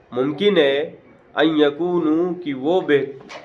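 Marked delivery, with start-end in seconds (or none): 1.3-2.3 slow melodic Quran recitation; measured Qur'anic chant; Quranic recitation. Shown as a demonstration. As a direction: draw the Arabic out slowly, chanting. Quranic verse recited in class.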